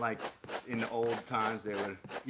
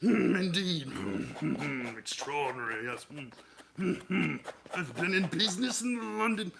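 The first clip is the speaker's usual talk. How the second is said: blustering British businessman, pitched up, with chortles; fat Victorian British businessman; 5 semitones higher than usual. Acted. Streamer riffing as a British businessman, hammed-up chortling voice. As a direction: huff and chortle like a rich British gent. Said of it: "a fat nineteenth century British businessman".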